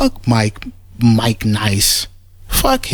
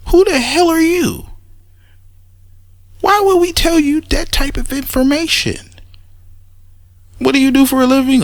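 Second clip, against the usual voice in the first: much higher imitated interrogating voice; suspicious family; 14 semitones higher than usual. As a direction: grill the stranger like a wary relative.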